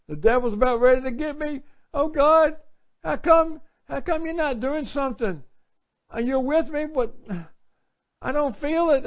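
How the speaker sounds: high, strained plea to God; frantic high voice, like a scared believer; panicked plea. Acted. Acting out desperate believer.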